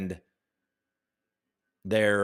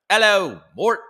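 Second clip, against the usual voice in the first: loud, higher mock British greeting; bad British accent; 6 semitones higher, 6 dB louder than usual. Streamer greeting a viewer in Yorkshire, bad British accent. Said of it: "Oh, 'cause of my accent".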